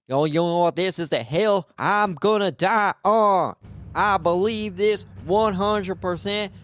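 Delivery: hammed-up, stretched-out proclamation; mock-solemn vow. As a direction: imitate someone grandly swearing to a cause. Acts out everyone's grand vow.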